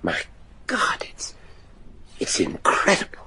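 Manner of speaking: breathless whispered horror at the sketch; barely voiced, stunned; awed whisper. Man stunned by the drawing of the creature, whispering in awe.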